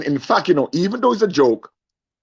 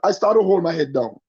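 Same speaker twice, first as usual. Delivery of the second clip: ordinary talk, nothing marked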